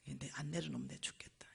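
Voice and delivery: very soft, low, half-whispered aside to oneself; tongue-biting mutter. Retold or imitated. Preacher recalls struggling with pride, muttering grimly to self. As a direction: mutter it under the breath, holding back. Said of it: "혀를 깨무른 거야".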